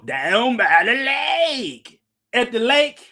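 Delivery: slow, drawn-out preacher voice, lake stretched long; sing-song the lake like Lovell Crawford; slow, stretched imitation of a preacher; Lovell Crawford impersonation. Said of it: "That's my Lovell Crawford impersonation".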